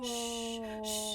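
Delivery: soft imitated hush; gentle shushing. Child comforting his panicking mother, gentle soft shush. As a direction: soothe like a sweet little child. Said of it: "he's being so sweet".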